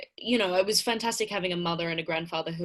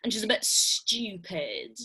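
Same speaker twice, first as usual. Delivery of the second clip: slow, drawn-out posh accent; 3 semitones higher, slower than usual. Shown as a demonstration. Actress shows how her character talks, slow posh accent. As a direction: put on a really posh, slightly dim accent. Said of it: "really posh accent, and she talks like this".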